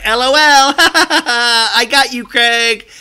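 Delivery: high, loud laughing taunt; mock gloating. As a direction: cackle it out as a gotcha. Mocking Paige gloating.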